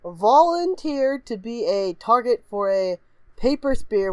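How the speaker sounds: usual speaking voice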